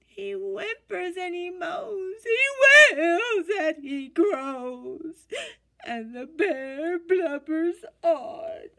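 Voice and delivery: slow, high, sobbing storytelling; crying narration. A narrator's reading. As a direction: tell it through mock sobs.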